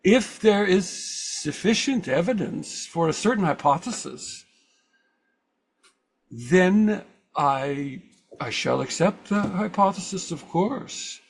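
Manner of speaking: imitating an old Swiss German speaker; Carl Jung Swiss accent. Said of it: "my best impression of Carl Jung with a Swiss accent".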